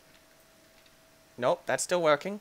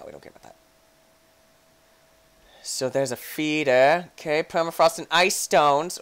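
ordinary delivery

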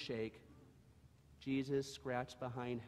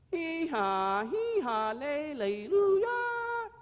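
high, loud donkey bray breaking upward; a donkey's cracked bray climbing to a squeak; broken hee-haw; 11 dB louder, much slower than usual. Acted. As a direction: Howie practicing his broken bray. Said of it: "Howie whispered, his broken hee-haw".

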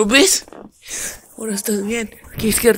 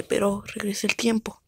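whisper; 5 dB quieter than usual. Acted. Whispered voice held.

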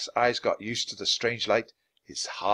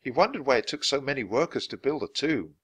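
ordinary delivery

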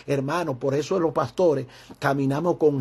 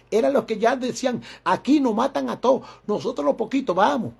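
higher, building preacher's cadence; fiery sermon build-up; preaching climax; 6 semitones higher than usual. Acted. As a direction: drive the repeated "eran los que" upward. Preaching climax, rising repetition.

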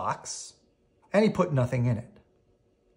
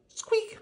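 toy squeak; 4 dB quieter than usual. Acted.

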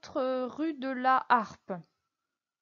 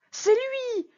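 much higher, loud outburst of recognition; startled cry; 12 semitones higher, 4 dB louder than usual. A narrator's reading. Prisoner recognising his wife's kidnapper, high startled cry. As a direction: blurt it out in shock. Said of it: "s'écria Bonacieux".

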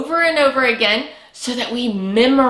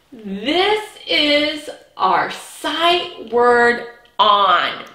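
very slow, drawn out "This is our sight word"; slow choral model; much slower than usual. Shown as a demonstration. Teacher says it with the class, very slowly. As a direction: stretch it out for children to echo. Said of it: "Can you say".

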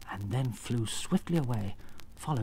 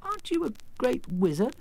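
Dorothy; 11 semitones higher than usual. Acted. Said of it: "asked Dorothy".

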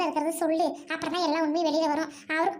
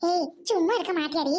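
ordinary talk, nothing marked

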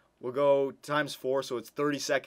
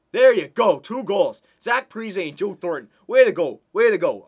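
loud, high cheers of delight; excited cheering; 5 semitones higher, 10 dB louder than usual. Real, not acted. Gamer cheering his team's two goals, loud and excited.

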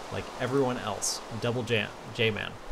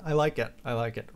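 ordinary talk

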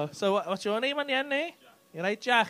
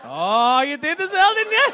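loud, laughing gotcha, high and gleeful; laughing teasing; 5 semitones higher, 10 dB louder, slower than usual. Real, not acted. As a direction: catch them out while cracking up. Teasing couple, laughing loudly.

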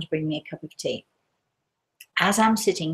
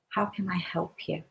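soft, tender offer of help; gentle; 10 dB quieter than usual. Retold or imitated. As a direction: speak softly to someone leaning on your shoulder. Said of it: "I very gently say to her".

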